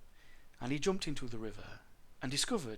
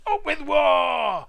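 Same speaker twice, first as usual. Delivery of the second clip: high, very loud, slow shout; full-voiced tyrannosaur chant; 13 semitones higher, 13 dB louder, much slower than usual. A narrator's reading. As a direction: bellow a drawn-out war cry. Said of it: "they shouted".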